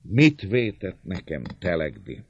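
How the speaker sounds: bitter echo of the question, stressed first word. A narrator's reading. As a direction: throw the question back bitterly. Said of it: "Kérdi az előbbi keserűen".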